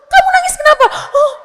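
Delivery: very high, loud, hammed-up mock questioning; imitated nagging mother. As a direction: pester a crying child in a squealing parody. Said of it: "Jangan juga ditanyain".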